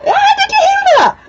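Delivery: high, loud burst of excitement; excited shout. Real, not acted. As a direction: shriek with delight at the cat. Excited at cat's noise.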